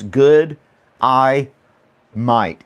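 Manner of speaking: "Good, I might" as three separate words; "Good, I might" word by word, pauses between; broken into syllables. Shown as a demonstration. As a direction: space out "Good... I... might" deliberately. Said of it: "And if you say that".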